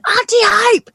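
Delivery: put-on high, light child's call. Little niece at the window, high child's call. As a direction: call out like a three-year-old at a window. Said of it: "she keeps coming to her window and going".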